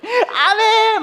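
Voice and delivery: very high, loud cried amen; fervent amen. Retold or imitated. Preacher mimicking the rally crowd, high cried amen. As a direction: cry out amen with fervor.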